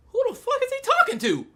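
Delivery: very high-pitched mock disbelief; incredulous bystander. People looking around at Raji, incredulous. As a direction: squeak it out, baffled. Startled bystanders react in retelling.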